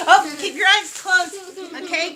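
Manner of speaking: ordinary delivery, nothing marked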